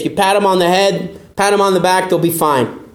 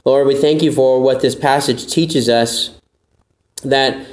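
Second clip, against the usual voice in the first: lower, reverent prayer voice; closing prayer; 6 semitones lower than usual. Acted. A pastor leads the closing prayer in a reverent voice. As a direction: pray humbly over the congregation.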